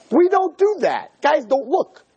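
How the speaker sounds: high and slow disbelief; incredulous high exclaim. Retold or imitated. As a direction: squeak it out in disbelief.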